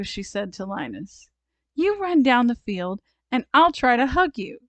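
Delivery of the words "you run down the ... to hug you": put-on higher, louder excited child; bubbly girl explaining her game; eager little girl. A narrator's reading. Sally eagerly plans the game.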